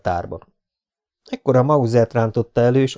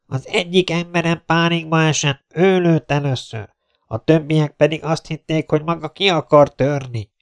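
raised-pitch, projected explanation; captain on loudspeaker; 6 semitones higher than usual. Acted. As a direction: call the explanation across to the besieged man. (hangszórón magyarázkodó százados)

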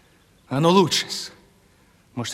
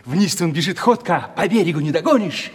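normal talking voice

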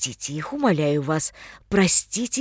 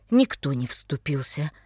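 ordinary delivery, nothing marked

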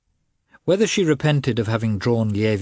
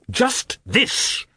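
much higher, sharp angry retort; angrily exclaimed. A narrator's reading. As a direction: throw it back at her in anger. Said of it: "exclaimed the prince angrily".

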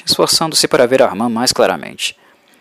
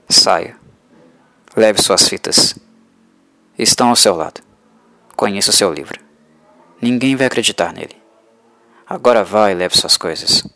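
put-on soft accented vampire; 3 semitones lower than usual. A narrator's reading. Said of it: "A voz era suave, com um leve sotaque".

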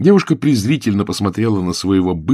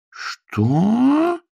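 outraged what. A narrator's reading. Governess outraged.

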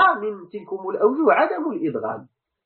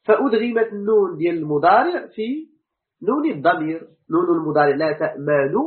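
normal talking voice

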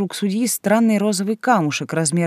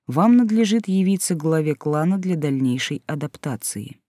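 ordinary delivery, nothing marked

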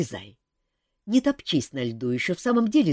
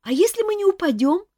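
high, louder worried squeak; anxious chick; 6 dB louder than usual. A narrator's reading. Chick asks if they will die, high anxious. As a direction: ask it alarmed.

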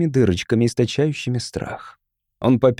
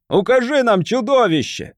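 high, insistent demand; commanding; 9 semitones higher than usual. A narrator's reading. Voice from the crowd demanding the monster, commanding. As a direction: demand that he point it out. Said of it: "скомандовал голос из толпы".

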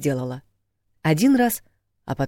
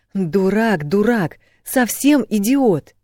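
insults climbing higher and louder, last one drawn out; getting worked up; 7 semitones higher, 6 dB louder, much slower than usual. A narrator's reading. Woman berating her man, increasingly heated. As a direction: build up the indignation with each insult. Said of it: "постепенно распаляясь".

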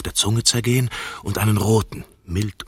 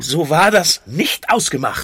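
louder, higher indignant complaint; 7 semitones higher, 7 dB louder than usual. Real, not acted. Man complains to his partner, indignant and loud. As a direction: protest the broken deal.

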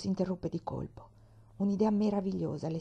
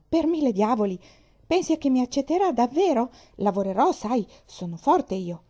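put-on loud, eager boyish outburst; louder, hoping hard to be taken in; excited; 5 semitones higher, 7 dB louder than usual. Orphan hopes for a home. (ragazzo entusiasta, a gran voce)

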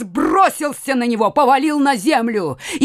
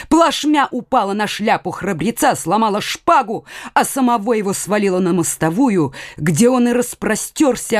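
ordinary delivery, nothing marked

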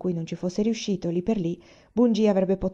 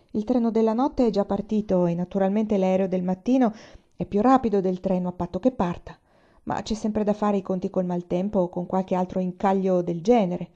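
ordinary delivery, nothing marked

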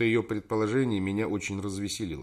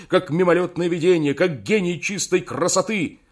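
higher, louder outraged tirade; indignant shouting; 7 semitones higher, 7 dB louder than usual. A narrator's reading. Outraged lover shouting at the secretary, an indignant tirade. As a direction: shout her down, lofty and furious. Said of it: "закричал на неё".

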